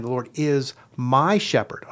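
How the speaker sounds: "my" drawn out long and slow; stress on my. Shown as a demonstration. Teacher demonstrating emphases in prayer, stress on "my". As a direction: stretch "my" to make it personal. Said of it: "you read it over with different emphases".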